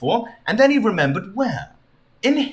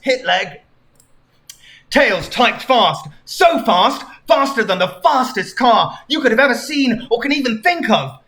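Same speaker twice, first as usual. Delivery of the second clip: plain reading voice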